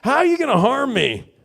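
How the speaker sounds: high, loud, daring come-at-me; a loud mock dare; cocky challenge. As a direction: taunt them to try it. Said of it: "be like".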